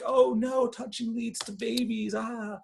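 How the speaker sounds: very high hammed-up alarm; mock panic. Counselors in diapers warning campers, hammed-up panic. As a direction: wail the warning in fake terror.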